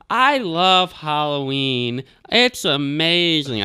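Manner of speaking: high, very slow mock child voice; mock little kid. Imitating the kid in front talking to grandpa, high and slow. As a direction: drag it out like a whiny little kid. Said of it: "and he's like".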